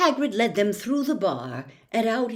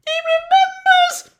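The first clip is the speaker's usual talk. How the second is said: very high and loud with delight; thrilled squeal; 11 dB louder than usual. Acted. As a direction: shriek with joy at being recognised. Diggle thrilled to be remembered.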